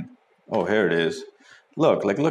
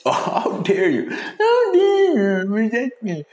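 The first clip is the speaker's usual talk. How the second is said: high, loud, slow mocking whine with a laugh; mocking girl; 6 dB louder, slower than usual. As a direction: parody her outrage, laughing. Mocks girl's "how dare you.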